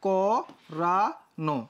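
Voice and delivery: করানো very slowly as ko... ra... no; syllable-by-syllable pronunciation. Shown as a demonstration. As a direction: sound out করানো with long gaps between syllables. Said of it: "করানো হয়ে যাবে".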